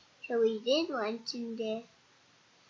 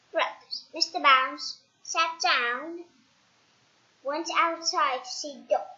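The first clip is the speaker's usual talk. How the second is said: ordinary delivery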